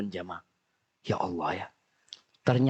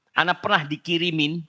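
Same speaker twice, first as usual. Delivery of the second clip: ordinary talk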